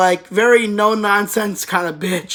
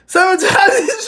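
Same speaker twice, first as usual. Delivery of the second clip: much higher, breaking into laughter on the last word; laughing; 10 semitones higher, 4 dB louder, slower than usual. Real, not acted. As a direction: lose it laughing as you finish counting. Counting times, cracking up.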